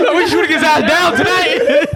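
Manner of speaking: high, laughing call to the mob; rally the haters, half laughing; mock hater rallying. Playing haters out to shoot down the flier, mock rallying.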